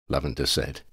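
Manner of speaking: plain reading voice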